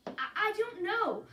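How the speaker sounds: agitated. Acted. Caitlin pressed by counselor.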